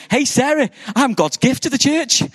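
high, loud triumphant announcement; cocky boast. Retold or imitated. Husband boasting to his wife, Sarah, loud and high. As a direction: crow it out like a proud fool. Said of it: "Don't say it like that".

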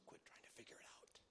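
hushed, breathy whisper; barely voiced, almost secret. Real, not acted. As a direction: drop to a whisper to draw them in. Preacher drops to a whisper.

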